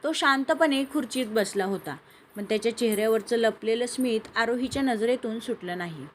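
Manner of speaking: usual speaking voice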